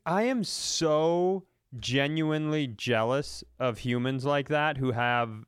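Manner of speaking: ordinary talk, nothing marked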